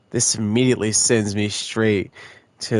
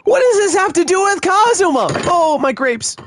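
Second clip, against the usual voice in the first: very high, louder outburst; hyped shriek; 7 dB louder than usual. Real, not acted. Streamer asks what this has to do with Kazuma, shrieking. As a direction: shriek it in disbelief. Screaming reaction to the reveal.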